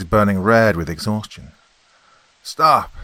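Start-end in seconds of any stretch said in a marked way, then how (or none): 2.4-2.9 loud, high command. Acted. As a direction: order her to stop. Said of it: "he said".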